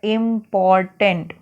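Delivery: इंपॉर्टेंट slowly broken into इम-पॉर-टेंट; three vowel sounds. Shown as a demonstration. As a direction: give each syllable of इंपॉर्टेंट its own slow beat. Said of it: "तीन बार हम vowel sound दे रहे हैं". (इंपॉर्टेंट के तीनों स्वर अलग-अलग, धीरे बोलना)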